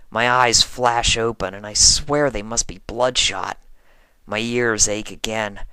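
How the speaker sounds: usual speaking voice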